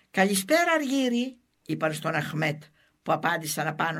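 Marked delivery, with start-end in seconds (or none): 0.1-1.4 mocking greeting. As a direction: stretch the greeting high to jeer at him. (κοροϊδευτικό, τραβηχτό καλησπέρα)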